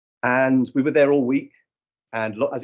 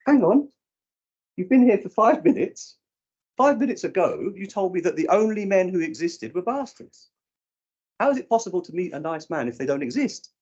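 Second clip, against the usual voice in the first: much higher, mock incredulous; incredulous retort; 8 semitones higher than usual. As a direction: poke holes in her logic, pitched up. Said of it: "And I went".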